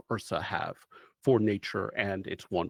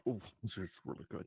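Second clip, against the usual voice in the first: quiet aside; 2 semitones lower, 11 dB quieter, much faster than usual. Acted. Aside while tasting the Danish.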